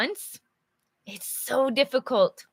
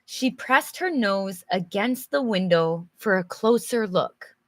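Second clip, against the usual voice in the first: ordinary talk, nothing marked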